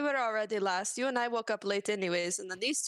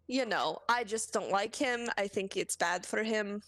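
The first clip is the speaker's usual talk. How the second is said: ordinary talk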